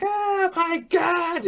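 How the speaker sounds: high, slow, drawn-out mock scream; imitating a scream. Retelling a woman screaming on the breaking ship, high mock shriek. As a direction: stretch it up into a shriek. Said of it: "she's screaming".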